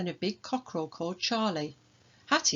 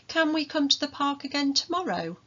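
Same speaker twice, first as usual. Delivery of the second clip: put-on much higher pleading little girl; hopeful child; 8 semitones higher than usual. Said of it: "Hattie asked".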